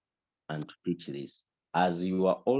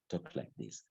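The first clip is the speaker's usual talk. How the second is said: 1 soft demo; 3 semitones lower, 11 dB quieter than usual. Shown as a demonstration. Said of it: "Don't talk like this".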